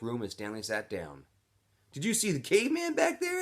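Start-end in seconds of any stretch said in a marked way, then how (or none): 1.9-3.4 put-on much higher, eager kid voice; much higher, lightly voiced teen; excited boy's question. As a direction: ask it like a wide-eyed younger kid. X-Ray asking Stanley.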